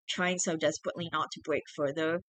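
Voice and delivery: usual speaking voice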